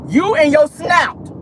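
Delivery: slow, loud, pointed jab; taunting call-out. Acted. Vlogger taunting Sheree about her nose, slow and loud. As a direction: drag it out to mock her.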